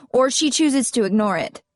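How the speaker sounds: usual speaking voice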